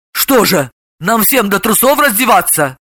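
ordinary delivery, nothing marked

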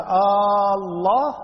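آه الله stretched slowly, rising on الله; drawn-out questioning Aah. Shown as a demonstration. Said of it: "استفهام". (مد آه بنبرة سؤال بطيئة)

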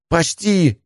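high, straining call from afar; strained shout. A narrator's reading. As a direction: shout it at the limit of effort. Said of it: "напряжённый до предела".

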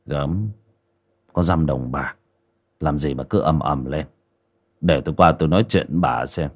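low, muttered husband's grumble; Hoàn's grumbling voice. Acted. Hoàn waves off his mother's fuss, low and grumbling. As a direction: drop the voice low and brush it off.

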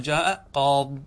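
قاض stopped on the heavy d, no i; ya dropped. Shown as a demonstration. Teacher shows pausing by deletion, qaad without ya. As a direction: cut the final ee off قاض. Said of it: "تحذف الياء".

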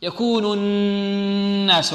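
يكون الناس very slow with a held nasal ghunna; light letters. Shown as a demonstration. Teacher recites slowly to show thin letters and ghunna. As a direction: stretch الناس, hum the noon. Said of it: "هذه كلها مرقة".

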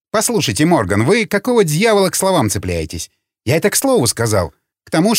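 high-pitched, exasperated self-defence; irritated retort. A narrator's reading. Official defends himself to Morgan over his words, irritated. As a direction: push back testily, pitch riding high.

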